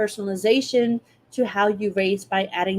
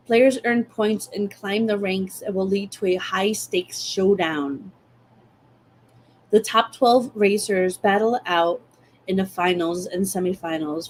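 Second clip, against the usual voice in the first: ordinary talk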